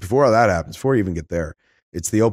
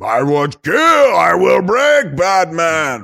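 booming, slow, much higher and louder brute bellow; hulking brute voice; 9 semitones higher, 7 dB louder, much slower than usual. Retold or imitated. Podcaster imagining a hulking brute's voice, slow booming shout. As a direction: roar it like a giant thug. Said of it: "what do you imagine to come out of him".

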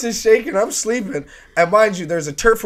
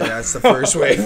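low, matter-of-fact report; housemate's low-voiced announcement, retold; 5 semitones lower than usual. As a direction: imitate him announcing it in a low voice. Retold housemate announcing the hurricane's first wave.

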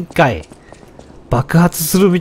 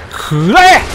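battle cry; 6 semitones higher, 3 dB louder, much slower than usual. Real, not acted. Attack cry in combat.

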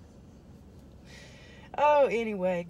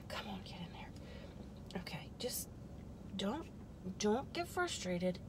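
unvoiced whisper under her breath; whisper to self; 11 dB quieter than usual. Real, not acted. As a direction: whisper as if coaxing the thing to fit. Muttering at fiddly setup.

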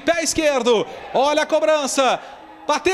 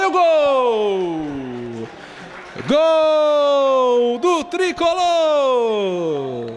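goal call; much slower than usual. Real, not acted. Goal scream by commentator.

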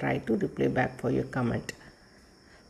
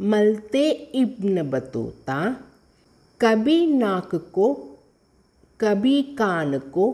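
poem recitation; 3 semitones higher than usual. Shown as a demonstration. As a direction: recite it rhythmically for young pupils. Reads the poem to pupils. (ஏற்ற இறக்கத்துடன் கவிதை வாசிப்பு)

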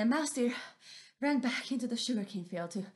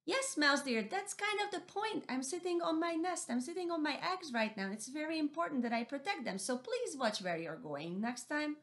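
lightly voiced higher owl, a little stern; patient Missus Owl; 7 semitones higher than usual. Missus Owl scolding mouse deer about her eggs. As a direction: gently set him straight.

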